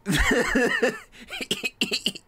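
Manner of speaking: high shrieking laugh; loud burst of laughter. Real, not acted. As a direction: break into a big laugh. Cracking up at the clip.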